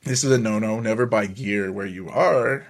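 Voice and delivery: usual speaking voice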